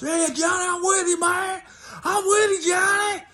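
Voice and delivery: very high, hammed-up hollering; egging-on buddy. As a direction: cheer Johnny on like a rowdy drunk. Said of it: "And then you got the other guys".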